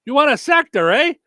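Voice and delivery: mock Minnesota cashier; Minnesota accent. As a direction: repeat it in a thick Minnesota accent. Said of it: "She goes".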